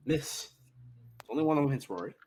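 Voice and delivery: ordinary talk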